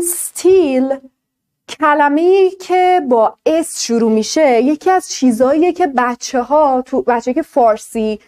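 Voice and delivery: ordinary talk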